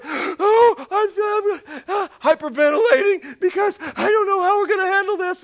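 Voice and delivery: high, loud hammed-up panic; mock panicky whiner. Preacher mocking worried believers, hammed-up high panic. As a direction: play the pouting baby hyperventilating. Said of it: "babies that pout or".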